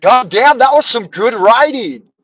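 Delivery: God damn, said high and loud with delight; delighted exclaim. Retold or imitated. Recalling his reaction to his own paragraph, thrilled. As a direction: marvel at your own writing. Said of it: "and I thought".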